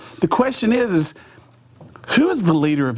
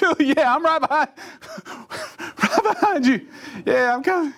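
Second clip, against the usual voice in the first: higher, with a laugh inside; nervous fake bravado; 9 semitones higher than usual. Preacher following Richard to the bungee, nervous and higher. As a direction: fake confidence through nerves.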